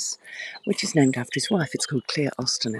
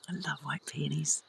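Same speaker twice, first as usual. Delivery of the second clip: whisper; 2 semitones higher, 8 dB quieter than usual. Real, not acted. Quiet admiring in the garden.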